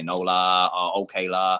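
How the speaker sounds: "no la" and "okay la" with a short low "la" tagged on; Hong Kong la particle. Shown as a demonstration. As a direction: add the Cantonese-style "la" to the end. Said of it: "You say".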